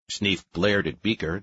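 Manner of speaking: ordinary delivery, nothing marked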